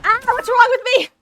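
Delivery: loud high despairing cry; anguished wail. Real, not acted. Gamer wailing after a blunder in the match. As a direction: wail it out at your own mistake.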